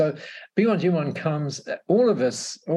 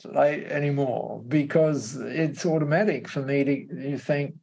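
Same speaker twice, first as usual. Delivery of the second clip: usual speaking voice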